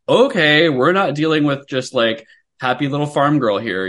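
mock taken aback. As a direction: act out a reader realizing it's serious. Voices a reader's startled reaction.

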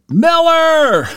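high, loud call across the campus; hollering a name. Retold or imitated. Friend calling out across the campus, loud high holler. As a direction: yell the name to catch a friend far off. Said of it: "David Brooker hollering at me".

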